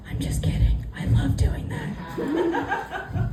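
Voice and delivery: whispered and slowed, gleeful menace; sinister whisper aside. Retold or imitated. God confiding to Lot, whispered and gleeful. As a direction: confess it in a wicked whisper.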